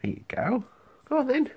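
high, soft pet talk. Real, not acted. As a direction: coax the hedgehog along. Talking to the pet hedgehog.

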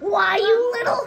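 angry shout. Acted. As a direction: snap and lunge in fury. Provoked dog snaps.

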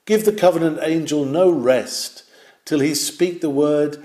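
usual speaking voice

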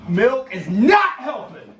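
loud, slow pained shout. Real, not acted. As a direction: yell it out through the burning. Burning mouth, shouting in pain.

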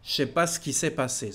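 quick, with the ne dropped and "Je sais" slurred to "chais"; fluent casual French. Shown as a demonstration. Teacher demonstrating the fluent spoken version, quick and relaxed. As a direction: say it fast and easy, like everyday talk. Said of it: "When you speak fluently".

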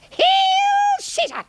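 very high, drawn-out puppet voice; tiny Roman soldier piping up; small soldier's salute. Acted. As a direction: squeak out a slow, eager salute. Little soldier Brutus salutes.

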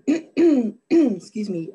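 clearing a scratchy throat; losing voice, throat clearing. Real, not acted. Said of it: "allergies have been terrible and I keep losing my voice here".

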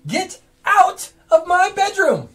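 furious shout. Acted. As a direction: scream him out of the room. Wizard throws intruder out.

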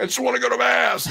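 very high-pitched, hammed-up tearful wail; high, strained mock sobbing plea; mock crying scream. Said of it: "I'm crying and screaming".

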